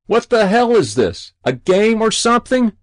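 fed up, shout it up at him; exasperated yell. Acted. Fireman yelling up at the boy, exasperated loud shout.

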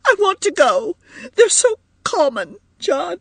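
very high, whining wail; distraught woman begging to leave; distressed cry. A narrator's reading. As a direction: plead tearfully in a high voice. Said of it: "cried Edith".

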